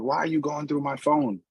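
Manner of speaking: high-pitched imitation of yelling; mock shouting. Man shouting at his mom about his phone, mock yell. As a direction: mimic a man yelling, pitch up but not volume. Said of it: "you can hear him shouting at his mom".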